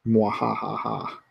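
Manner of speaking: slow low mock evil laugh; sneering panto villain. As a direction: cackle like a pantomime baddie.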